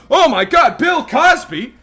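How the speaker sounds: high, loud fan gushing; starstruck neighbor. Acted. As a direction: gush like you just met your childhood hero.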